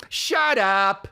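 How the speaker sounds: high theatrical retort; overdone fifties shut up. Retold or imitated. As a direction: imitate the co-star hamming his line. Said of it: "like that".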